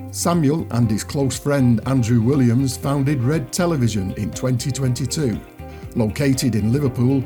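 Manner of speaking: normal talking voice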